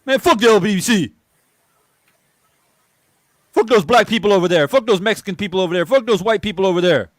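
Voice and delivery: loud, high, ranting mock tirade; same hypocrites ranting, high and loud; mock angry hypocrite. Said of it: "Fifteen minutes later".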